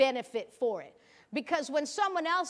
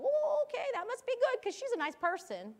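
much higher, naive agreeing voice; mock a trusting listener, pitched way up; gullible churchgoer; 11 semitones higher than usual. Churchgoer trusting the nice pastor without reading, naive high agreement. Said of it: "And you'll go".

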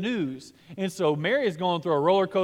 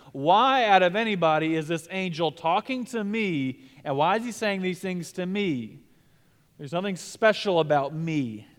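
ordinary delivery

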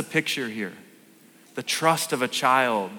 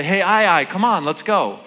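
put-on bright, louder call to a toddler, pitched up; calling out to daughter; 6 semitones higher, 6 dB louder than usual. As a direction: call her over playfully, higher and louder. Calling his daughter to come.

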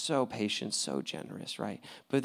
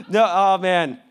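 ordinary delivery

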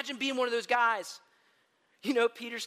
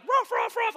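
dog bark imitation; 7 dB louder than usual. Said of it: "Rocky was like".

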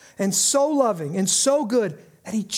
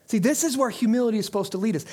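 ordinary talk

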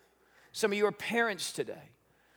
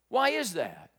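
usual speaking voice

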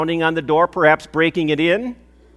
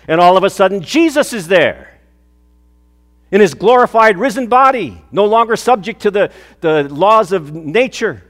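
louder, rising preacher's build of awe; preaching fervour; 4 semitones higher, 5 dB louder than usual. Acted. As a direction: proclaim the sudden risen Jesus.

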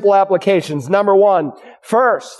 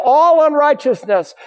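ALL pitched high in "all unrighteousness"; stress on ALL; 5 semitones higher than usual. Real, not acted. Preacher stressing that God cleanses "all unrighteousness", stress on ALL. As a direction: hit ALL high and hold it out before "unrighteousness". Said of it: "A double L".

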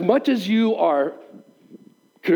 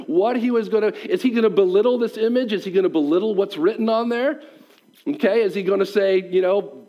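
usual speaking voice

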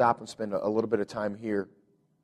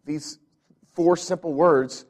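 higher, louder preaching on sin and separation; preaching at full force; 4 semitones higher, 5 dB louder than usual. Acted. Pastor preaching to the church on sin, fervent. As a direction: drive it home to the congregation.

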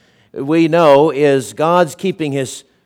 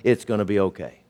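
lower, softer, settled calm; calm reassurance; 5 semitones lower, 7 dB quieter, faster than usual. Retold or imitated. The friend answering worried colleagues, calmly and softer. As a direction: answer the panic with quiet certainty. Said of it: "he said".

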